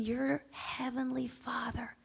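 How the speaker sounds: whispered, slow and hushed. Real, not acted. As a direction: drop to a whisper for Heavenly Father. Hushed aside to listeners.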